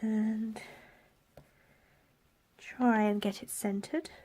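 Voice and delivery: ordinary talk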